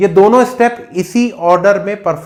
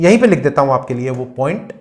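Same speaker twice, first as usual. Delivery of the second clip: usual speaking voice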